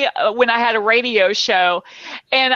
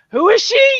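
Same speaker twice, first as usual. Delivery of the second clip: high, louder curious whisper-like question; curious bystanders; 11 semitones higher, 4 dB louder than usual. Retold or imitated. Onlookers at the airport asking curiously, high. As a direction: ask as a nosy onlooker. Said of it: "everyone else is like".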